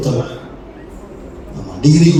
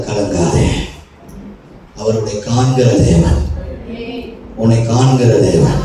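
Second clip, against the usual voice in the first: gentle divine comfort. Acted. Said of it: "கர்த்தர் இன்னைக்கு சொல்றாரு".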